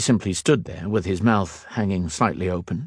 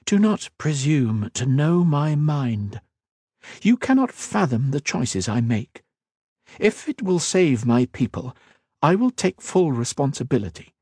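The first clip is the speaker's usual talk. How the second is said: a hint of a proud queen, raised a little; regal queen, lightly voiced; 6 semitones higher than usual. Queen questioning the mage, lightly voiced regal. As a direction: speak as a wary, commanding queen. Queen defends her choices.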